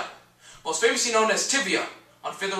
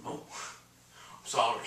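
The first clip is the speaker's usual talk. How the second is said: soft, low aside; small, lowered sorry; quiet apology; 6 semitones lower, 9 dB quieter than usual. Acted. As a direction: drop right down to apologize. Backs down after insulting the interviewer.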